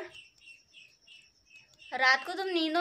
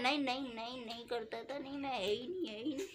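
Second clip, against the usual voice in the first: softer mock mumbled denials; imitating sleep talk; 10 dB quieter than usual. Wife imitates husband denying things half asleep, softly. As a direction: mimic her husband muttering in his sleep. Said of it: "यूँ बोल रहे।".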